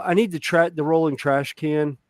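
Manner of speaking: ordinary talk, nothing marked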